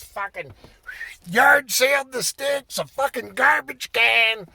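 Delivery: ordinary talk, nothing marked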